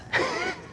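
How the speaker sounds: short squealing laugh; delighted high laugh. Real, not acted. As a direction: burst of glee. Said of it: "It was the best".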